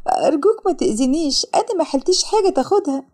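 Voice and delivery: high, mildly tearful begging; tearful pleading. A narrator's reading. Sara begging a mugger not to hurt her, tearful pleading. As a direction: plead as a crying, frightened woman. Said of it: "وسارة ببكاء".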